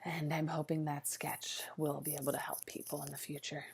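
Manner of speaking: soft unvoiced whisper; whispered hope. Vlogger whispering her hope to viewers. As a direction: whisper it as a sincere aside.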